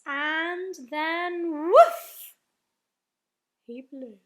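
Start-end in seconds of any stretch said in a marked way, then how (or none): 1.5-2.3 sudden loud, long whoosh; blown woof. A narrator's reading. Blowing the trumpet at the giant's face, explosive woof. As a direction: puff out a big drawn-out woof.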